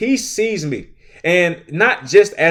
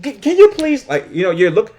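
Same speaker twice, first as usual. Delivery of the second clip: usual speaking voice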